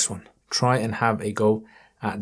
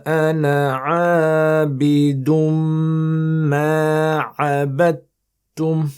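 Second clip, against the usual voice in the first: Quran recitation; 5 semitones higher, 5 dB louder than usual. Shown as a demonstration. Teacher reciting for students, melodic tajweed chant.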